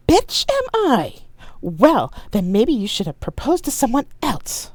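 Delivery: very high, loud, scandalized retort; outraged. Acted. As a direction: throw the insult back, outraged. Staged quarrel with fiancé.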